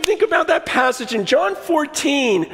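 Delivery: usual speaking voice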